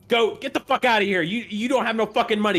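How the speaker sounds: high, loud furious shout; barmaid shouting. Acted. Barmaid Lilia throws the broke drunk old man out, furious shout.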